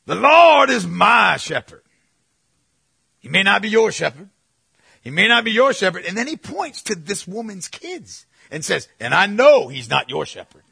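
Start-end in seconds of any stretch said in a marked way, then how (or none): 0.1-1.8 shouted, slow and much louder, every word hammered; mock fire-and-brimstone preacher. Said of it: "this is how he did it". 3.2-6.0 louder and higher, leaning hard on "your"; mock overblown preacher. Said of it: "this is how he did it". 9.0-10.6 put-on louder, pointing the line at the kids, laugh breaking through; imitating pompous preacher. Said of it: "this is how he did it".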